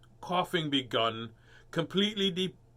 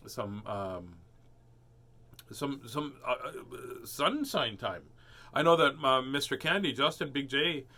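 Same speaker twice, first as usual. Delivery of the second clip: ordinary delivery, nothing marked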